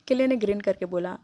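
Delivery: ordinary delivery, nothing marked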